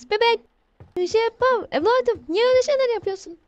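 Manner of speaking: yüksek, korkmuş yalvaran ses; bebeğe korkuyla yalvar, hızlanarak; korkmuş yalvarış. Real, not acted. (bebeğe yalvaran oyuncu, korkmuş ve tiz)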